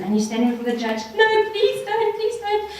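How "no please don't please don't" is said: high, slow, pleading no, please don't; mock begging. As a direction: act out a desperate plea to the judge. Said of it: "you're begging with him, pleading with him".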